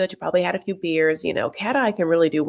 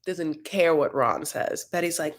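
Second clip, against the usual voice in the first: normal talking voice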